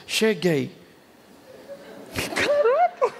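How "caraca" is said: stretched-out laughing exclamation, much higher; playfully amazed at arriving in heaven. Pretend. A newcomer marvels on reaching heaven, stretched-out laughing exclamation.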